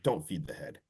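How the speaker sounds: low, soft deadpan warning; so serious. Retold or imitated. Tom warning Grace about the head, low and deadpan. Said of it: "so serious again".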